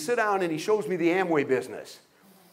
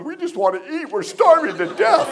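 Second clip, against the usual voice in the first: mock crying wife; 7 semitones higher, 3 dB louder than usual. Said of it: "And she started crying".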